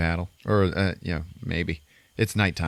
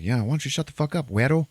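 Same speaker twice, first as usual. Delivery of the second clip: higher-pitched mock irritation; retold bickering; 7 semitones higher, faster than usual. Gunman bickering with his rival, mock irritation. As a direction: needle him with a mock tell-off. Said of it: "they're bickering and stuff".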